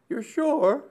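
pitched far up in alarm; a sharp high jolt of worry; anxious. Acted. Myrtle pressing for certainty, high and alarmed.

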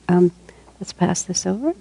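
ordinary talk

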